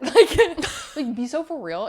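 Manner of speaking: laughing, high and loud; laughing disbelief. Real, not acted.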